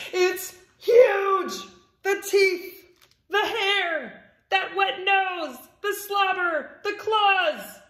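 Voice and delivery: high, loud, panicked list; freaked out. Acted. Pigeon facing an enormous puppy up close, freaked out. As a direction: cry out each item in alarm. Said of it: "He's so freaked out".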